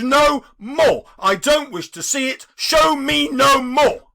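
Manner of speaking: loud anguished cry; cried out. A narrator's reading. Scrooge crying out against the ghost, loud and pained. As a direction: cry out in protest. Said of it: "cried Scrooge".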